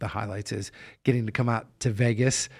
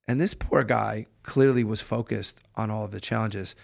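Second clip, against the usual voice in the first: ordinary talk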